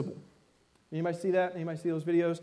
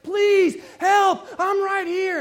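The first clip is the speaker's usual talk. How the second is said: much higher and louder desperate cry; panicked scream; 12 semitones higher, 7 dB louder, much slower than usual. Retold or imitated. Panicked girl pleading with family to see her, screaming. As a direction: beg for help at the top of your lungs. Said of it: "she's screaming at the top of her lungs".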